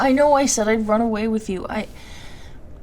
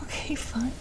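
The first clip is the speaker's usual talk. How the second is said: whispered give-in; 3 semitones higher, 9 dB quieter than usual. Real, not acted. Giving in to the hug.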